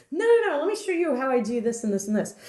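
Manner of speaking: a hammed-up domineering professor; mock Higgins. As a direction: rattle it off like an obsessive teacher. Higgins voiced insisting.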